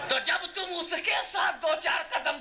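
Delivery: high-pitched rhetorical challenge; impassioned preaching. Real, not acted. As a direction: push the argument up to a high finish.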